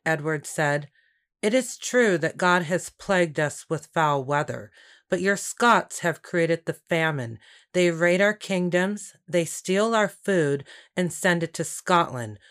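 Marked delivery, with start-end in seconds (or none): none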